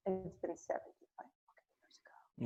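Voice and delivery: exasperated whisper; whispered aside. Real, not acted. Ranting about Victory Day to a friend, whispered. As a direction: hiss it under your breath.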